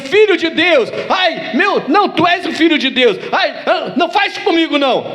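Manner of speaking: high, pleading devil voice; cowering devil. Acted. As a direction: beg for mercy as a frightened demon. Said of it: "é assim que o diabo tem que se sentir".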